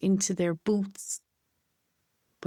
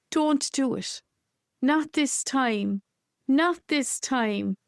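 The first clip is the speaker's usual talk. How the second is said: pleading cry; 6 semitones higher than usual. Acted. The people plead with the officials to stop.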